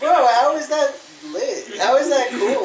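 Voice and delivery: loud, slow, disbelieving high-pitched outburst; incredulous. Retold or imitated. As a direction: act baffled and laughing at it. Mocking a boring art piece.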